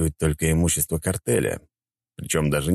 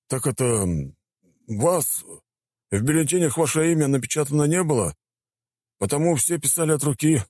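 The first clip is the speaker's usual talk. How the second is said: higher, hesitant official admitting news; awkward mayor; 9 semitones higher than usual. A narrator's reading.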